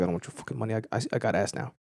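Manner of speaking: low, soft imitation of a friend; imitating a friend. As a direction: mumble it as the friend, dismissively. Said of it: "he's like".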